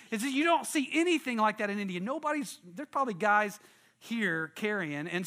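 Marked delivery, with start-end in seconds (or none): none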